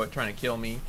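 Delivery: ordinary talk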